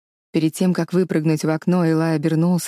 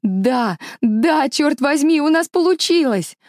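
building from a little louder to high triumphant cry; rising excitement; 9 semitones higher, 4 dB louder, slower than usual. Acted. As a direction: let the joy burst out step by step. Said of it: "затем сказала чуть громче".

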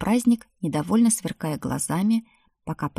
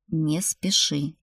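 slow, sober warning; turning serious; much slower than usual. A narrator's reading. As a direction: say it slowly and seriously to check him. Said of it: "посерьёзнел Ригвальд". (серьёзно, медленно)

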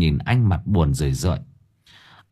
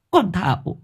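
wailing sob; 14 semitones higher than usual. A narrator's reading. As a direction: wail out the daughter's death. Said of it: "Chị Hoa mếu máo".

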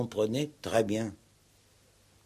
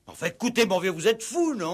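much higher, louder, exasperated; 10 semitones higher, 5 dB louder, faster than usual. Acted. Commissaire losing patience with Victor, exasperated. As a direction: cut through the babble impatiently.

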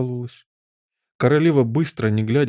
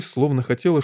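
ordinary delivery, nothing marked

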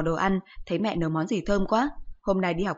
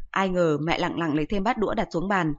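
ordinary delivery

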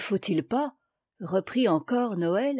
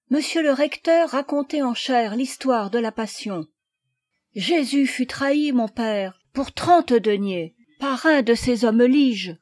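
put-on slightly raised, insistent boy's voice; a hint of an urgent young lad; lightly voiced eager boy; 4 semitones higher, 4 dB louder than usual. A narrator's reading. Boy Noël argues his point.

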